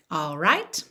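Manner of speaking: ordinary talk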